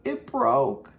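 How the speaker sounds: high mock mom voice, sorry; imitating mom. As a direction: whine it like mom did. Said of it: "She's like".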